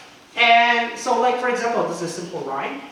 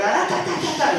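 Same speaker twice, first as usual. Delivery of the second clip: high, rapid excited "da, da" babble; laughing, high-pitched mock-frantic chant; kids going crazy; 10 semitones higher, 4 dB louder, faster than usual. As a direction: imitate overexcited children chanting da. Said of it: "the kids will go crazy".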